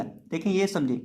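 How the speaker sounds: ordinary talk, nothing marked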